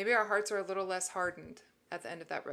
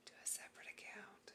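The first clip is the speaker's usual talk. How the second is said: conspiratorial whisper; 18 dB quieter, faster than usual. Acted. Advice given as a sly aside.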